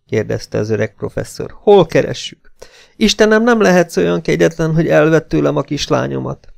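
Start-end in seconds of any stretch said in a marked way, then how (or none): 1.6-6.5 high-pitched anguished pleading; distraught plea. A narrator's reading. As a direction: plead with heaven for your child. Distraught father pleads for daughter.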